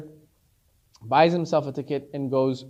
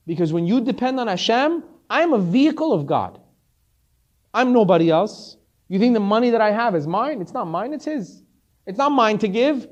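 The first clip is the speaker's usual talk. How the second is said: raised, impassioned explaining; emphatic declaration; 5 semitones higher, 6 dB louder than usual. Retold or imitated. Rich man explains he is only God's vehicle, emphatic declaration. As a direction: drive the point home in a higher voice.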